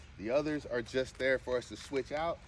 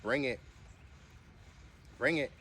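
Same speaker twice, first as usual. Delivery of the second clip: ordinary talk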